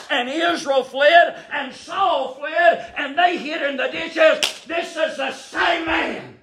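preacher building to a shout; rising preaching climax. Acted. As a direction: drive the story up to a peak.

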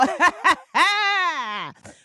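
high hearty cackle; auntie cackle. Retold or imitated. Jennifer Lewis style auntie, loud high cackle. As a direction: let a big auntie laugh roll out. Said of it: "like a good auntie cackle".

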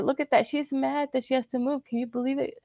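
high, fading catty gossip; mocking gossip. Mimicking the manager whispering about her move. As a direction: keep the snide gossip voice up high, then let it trail off.

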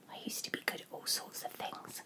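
soft whisper to herself. Acted. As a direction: whisper it almost silently. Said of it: "she whispered".

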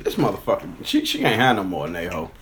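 ordinary delivery

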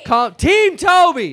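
loud, high, drawn-out call; rallying shout. Real, not acted. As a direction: shout down the squabble. Quelling an on-stage squabble.

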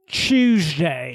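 drawn-out, muddled British-accent mimicry; mock British accent. Co-host mimicking the reporter's odd accent, mock British. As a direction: stretch it in a hammed-up British accent. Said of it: "it sounded a little British".

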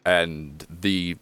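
slow, drawn-out servant voice; disheveled butler. Acted. Butler turning to the small guest, slow and deferential. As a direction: draw it out like a butler. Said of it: "He just goes".

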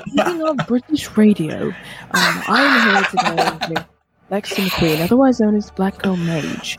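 mock British radio announcer, pitched a little low. Co-host playfully introducing a guest on air, quiet British radio voice. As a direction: put on a hushed British accent for radio. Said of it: "your British accent is just, your British accent is a British accent and also quiet".

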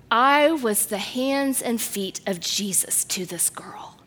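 hushed whisper of devotion; reverent whisper. Real, not acted. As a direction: breathe it out like a sacred secret. Climactic testimony statement.

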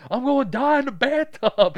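put-on high, loud little-kid wail; hammed-up child panic; panicked six-year-old. Retelling his childhood fear of the drain, mock panicked kid. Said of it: "that's me as a six-year-old".

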